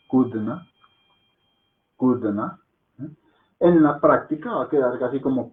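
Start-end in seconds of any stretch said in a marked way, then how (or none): 0.1-0.7 Kudna with a short, swallowed middle A and a rising end; correct Hindi pronunciation. Shown as a demonstration. Teacher models the word Kudna for Spanish speakers, careful demo. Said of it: "la pronunciación correcta es esta". 2.0-2.6 Kudna with a short, swallowed middle A and a rising end; correct Hindi pronunciation. Shown as a demonstration. Teacher models the word Kudna for Spanish speakers, careful demo. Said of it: "la pronunciación correcta es esta".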